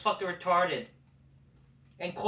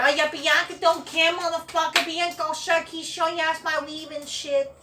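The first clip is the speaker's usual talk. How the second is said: high, loud sassy mock voice, pitched way up; mocking Bianca impression; 10 semitones higher, 5 dB louder than usual. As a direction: lampoon her swagger, shrill and cocky. Mocking Bianca Belair's attitude.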